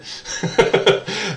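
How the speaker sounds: short real laugh at the mix-up; amused laugh. Real, not acted. Teacher laughing about the Lee Jordan slip. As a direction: chuckle at the blunder.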